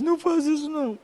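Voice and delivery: put-on girl begging the pastor not to apologize publicly; young girl. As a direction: plead like a tearful teen girl. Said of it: "Aí ela disse".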